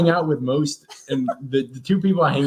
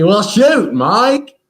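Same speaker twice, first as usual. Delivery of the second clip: high, loud country exclamation; country twang greeting; 8 semitones higher, 5 dB louder, much slower than usual. Retold or imitated. Buddies from Arkansas talking, high country twang. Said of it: "I say".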